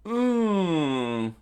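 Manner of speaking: loud, high, falling "ooh"; drawn-out cartoon wail; impact groan. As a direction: moan as if knocked flying. Villain crashes into building.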